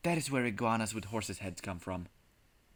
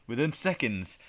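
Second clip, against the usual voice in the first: usual speaking voice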